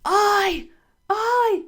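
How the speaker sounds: high, loud shout. Pretend. Body demanding attention, loud high shout. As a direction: bark it out to grab attention. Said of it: "it starts going".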